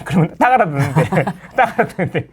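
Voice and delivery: chuckling through the words; real amused laughter; laughing. Real, not acted. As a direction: laugh as you say it. Laughing at own story.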